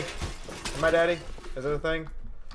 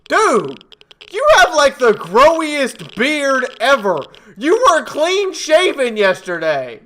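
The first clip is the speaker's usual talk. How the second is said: amazed shout; 8 semitones higher, 8 dB louder, slower than usual. Real, not acted. Reacting to character's beard.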